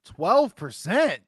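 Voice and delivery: loud, drawn-out disbelieving question; incredulous. Real, not acted. Co-host challenging the Sixers championship odds, incredulous.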